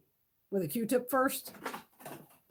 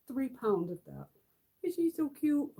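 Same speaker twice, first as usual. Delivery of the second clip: ordinary talk, nothing marked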